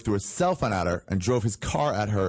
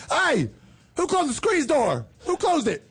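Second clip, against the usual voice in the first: high squeezed yelling voice; Larry Bird impression; 4 dB louder than usual. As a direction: yell it high like a face stuck in a door. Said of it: "my impression of Larry Bird caught in a screen door".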